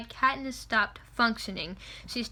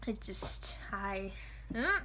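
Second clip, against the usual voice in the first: whispered; 4 semitones lower, 10 dB quieter, much slower than usual. Real, not acted. Lost for words over Plim.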